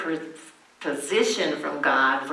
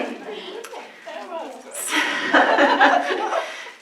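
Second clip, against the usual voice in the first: real laugh; 13 semitones higher than usual. Real, not acted. Laughs after her own joke.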